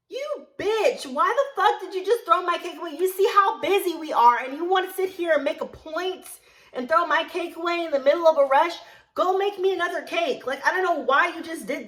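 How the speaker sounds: high, loud, outraged tirade; angry yelling. Retold or imitated. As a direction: re-enact a furious telling-off at a coworker. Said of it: "I'm yelling at her".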